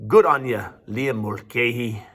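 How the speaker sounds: a light, clumsy attempt at an Irish accent; hint of an Irish lilt, played for fun; bad Irish accent. Teacher congratulating a student in his self-declared terrible Irish accent. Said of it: "That was my best, best Irish voice".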